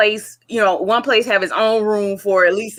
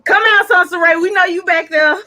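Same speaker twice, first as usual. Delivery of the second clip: mock summons, raised voice with a laugh in it; playful calling out; 7 semitones higher, 4 dB louder than usual. Host teasingly calls someone hiding in the back, calling out. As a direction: call out loudly and higher, half laughing.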